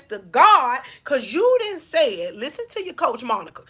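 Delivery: normal talking voice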